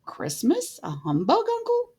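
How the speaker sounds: high rising astonished question; can't believe his ears; nephew's disbelief. A narrator's reading. Nephew questioning his uncle in high astonishment. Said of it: "said Scrooge's nephew".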